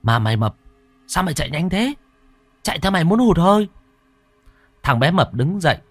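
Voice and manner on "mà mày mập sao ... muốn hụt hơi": much higher, fast, a touch winded; boy teasing his fat friend after running, fast and high; teasing breathless kid. Acted. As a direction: tease him quickly, still catching breath. Boy teases while panting.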